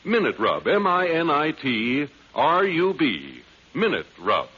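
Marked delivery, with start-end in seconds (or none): none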